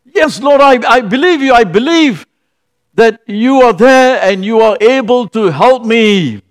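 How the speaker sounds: man hanging from a tree answers God, excited. Retold or imitated. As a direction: blurt out belief in a rush of relief. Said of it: "this man was so excited".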